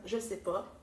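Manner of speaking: normal talking voice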